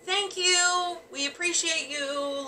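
high, slow, sing-song thanks to the ghost; lightly voiced sweet thanks to a ghost; mock sweet thanks. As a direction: coo it like thanking a friendly spirit. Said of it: "Most of our other ghosty things were just like".

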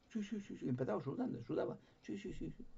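quick, soft, high nervous yeses; a frightened man agreeing too fast. Retold or imitated. Nervous Javier, sweating, agreeing.

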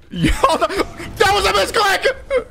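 very high-pitched mock scream; hammed-up screamed protest. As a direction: yelp it at the top of your lungs. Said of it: "He screamed at the top of his lungs".

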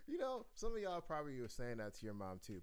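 usual speaking voice

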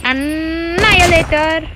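hyped, lingering callout; drawn-out announcement. Real, not acted. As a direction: stretch the word out grandly. Announcing weapon against chopper.

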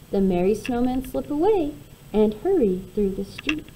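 plain reading voice

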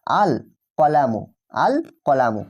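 আল কলামু with the lam of আল sounded clearly. Shown as a demonstration. Teacher shows students the pronounced lam, clear L in আল কলামু. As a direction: say আল, then কলামু, keeping the l distinct. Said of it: "with clear আমরা পরিষ্কারভাবে কিন্তু pronunciation করছি".

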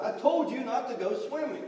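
acted motherly telling-off; scolding mother. A narrator's reading. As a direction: tell off a disobedient child. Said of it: "his mother scolded".

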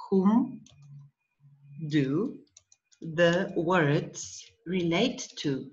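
word by word, with pauses between; slow dictation. Shown as a demonstration. Teacher repeats question for student to write, slow dictation. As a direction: dictate it slowly for a learner.

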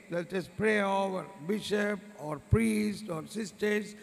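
normal talking voice

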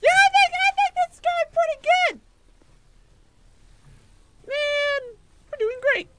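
high, loud mock-cheerful squeak; sarcastic falsetto. Player pretending the losing battle goes well, mock falsetto cheer. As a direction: chirp fake joy while losing.

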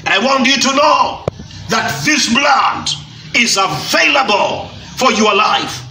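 ordinary talk

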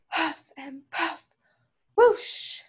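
slow puffs, then a high whoosh; blowing sound effects. A narrator's reading. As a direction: act out huffing and puffing into a gust. Narrator imitates the wolf blowing.

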